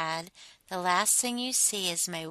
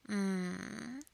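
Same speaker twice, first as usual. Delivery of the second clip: plain reading voice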